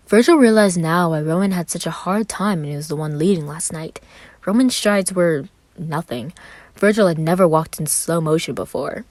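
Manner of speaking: ordinary delivery, nothing marked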